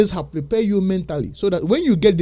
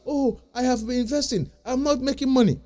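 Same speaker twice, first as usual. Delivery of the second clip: higher, imitating a discouraged investor; mock whining; 7 semitones higher than usual. Mocking investors who see no profit, whiny imitation. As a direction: parody the doubters' moaning. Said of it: "But you see it as".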